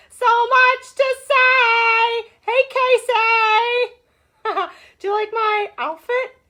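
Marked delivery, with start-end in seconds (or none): 0.1-2.3 louder, slow, drawn-out sung shout of so much to say; stretched shout. Acted. Host opening her live show, belting the catchphrase out slow. 2.4-3.9 excited greeting. Real, not acted. Greeting viewers on live stream.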